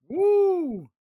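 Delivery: high whoop of joy; excited whoop. Real, not acted. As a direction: cheer it out loud and high. Celebrating value pick.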